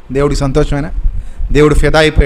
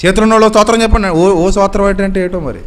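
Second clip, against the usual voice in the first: fast, higher rattled-off pastor's command; mocking a pastor; 4 semitones higher than usual. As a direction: rush it like a pastor on autopilot. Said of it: "అంటాడు గురువుగారు".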